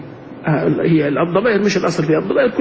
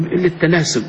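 usual speaking voice

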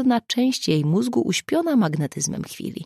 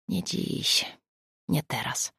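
soft, near-whisper; quiet, whispery restraint; hushed inner thought; 2 semitones lower, 7 dB quieter, slower than usual. A narrator's reading. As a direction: murmur it privately to himself. Man's private thought, holding back.

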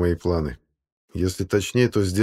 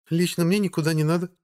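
female character voice; 9 semitones higher than usual. A narrator's reading. Margo character voice.

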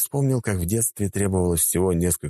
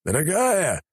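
bright, slow, happy call; proudly calling out to his wife; cheerful exclaim; 13 semitones higher, slower than usual. A narrator's reading. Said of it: "воскликнул он".

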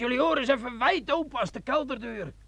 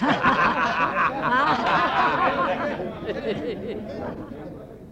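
sinister laugh. Acted. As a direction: laugh like the devil is in the bottle. Sergeant laughs over bloody wine. (lang duivels gelach)

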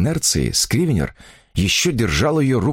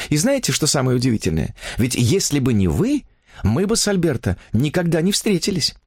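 higher-pitched chattering heroine; infatuated young woman; 8 semitones higher than usual. A narrator's reading. Woman confiding her new romance to a writer, higher enraptured voice.

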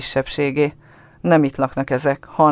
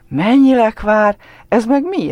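put-on amazed child; 8 semitones higher, 6 dB louder, slower than usual. A narrator's reading. Boy amazed.

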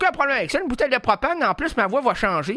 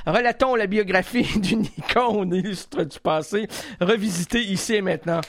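pompous documentary read, stretched out; hammy narrator with a laugh inside; grand narrator intro; 2 semitones lower than usual. Acted. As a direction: announce it like a solemn biography.

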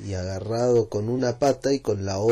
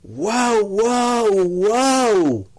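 very high, loud cries of "guau"; excited shout; 11 semitones higher, 8 dB louder, much slower than usual. Acted. José Luis shouting at the moon, excited barking yell. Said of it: "Y ahora sí que gritó".

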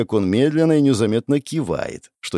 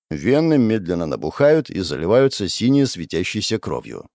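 plain reading voice

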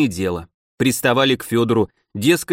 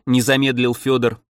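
ordinary delivery, nothing marked

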